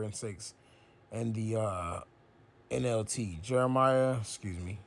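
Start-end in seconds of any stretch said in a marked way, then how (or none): none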